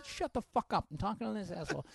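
high-pitched, irritated retort; comic heckler put-down. Retold or imitated. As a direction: snap back as at a heckler. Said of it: "heckler instinct kicks in".

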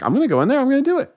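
pumped to go do it; mildly excited friend. Acted. Friend announces converting.

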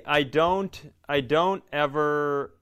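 usual speaking voice

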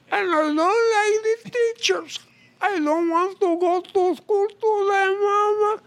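high, whining fake-crying child; whiny crying kid. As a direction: whimper like a kid refusing school. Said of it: "he puts them in his eyes and he says".

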